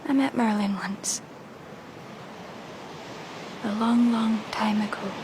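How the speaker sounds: dreamy whisper. Acted. Rhiannon whispering dreamily on the cliff edge. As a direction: drift the words out, far away. Dazed woman on a ledge.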